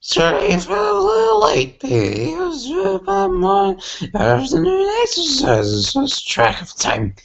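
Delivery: very high squeaky pony voice; high cartoon pony. Pony apologizing to her friend for being late. Reading Rainbow Dash voice.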